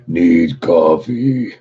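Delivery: slow groaning zombie voice; zombie moan. Retold or imitated. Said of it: "In my best zombie voice, bro".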